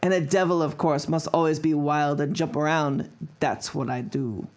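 put-on German accent waning toward the end; fading German accent. Finishing the quote, accent fading toward plain. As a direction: let the German slip as it goes. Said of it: "That was a terrible accent".

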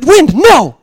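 put-on high, loud childish scolding shout; imitating son's yell. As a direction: shout a command like a small boy. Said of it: "he thinks you can yell at the wind".

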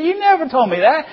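very high, loud denial; hammed-up indignant comeback; protesting son. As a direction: protest back like a scolded kid. Acted son shouts back denial.

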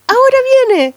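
high, loud urgent call; urgent announcement. Retold or imitated. Midwife telling the mother the baby is coming, high urgent call. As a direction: call it out like it's happening now.

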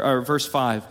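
ordinary talk, nothing marked

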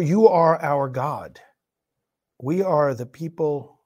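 measured, low-key praying voice; solemn prayer. Real, not acted. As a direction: address God humbly, pausing between phrases. Leads a prayer.

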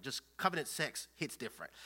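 ordinary talk

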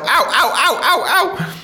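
string of high, sharp "ow" yelps; pained yelps. Acted. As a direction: yelp at every painful step. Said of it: "stab yourself".

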